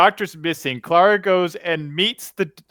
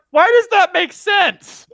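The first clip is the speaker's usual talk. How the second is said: high, loud and slow, baffled disbelief; incredulous outcry; 14 semitones higher, 7 dB louder, slower than usual. Retold or imitated. Fan mocking the show's logic to her co-host, in an incredulous outcry. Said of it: "I'm like".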